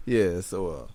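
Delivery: ordinary talk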